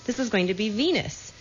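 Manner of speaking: ordinary talk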